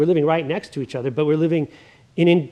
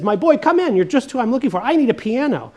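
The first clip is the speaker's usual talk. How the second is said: much higher, booming, hearty greeting; hearty salesman welcome; 8 semitones higher, 4 dB louder, faster than usual. Acted. Mister Blandings greeting the piano salesman, hearty and booming. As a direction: welcome him in like an old friend. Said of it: "welcomes him with a big handshake and says".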